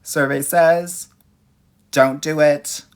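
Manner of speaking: loud, slow game show reveal; game show host. Pretend. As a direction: boom it out like a quiz host. Game show reveal repeated louder.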